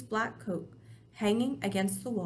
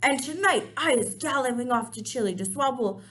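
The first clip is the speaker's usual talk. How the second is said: gloating giant; 3 semitones higher, 6 dB louder than usual. Acted.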